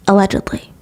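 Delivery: ordinary talk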